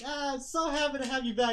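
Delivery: high, loud, happy gushing; delighted. Acted. Kenji gets the shield back, high and delighted. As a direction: gush with joy in a high voice.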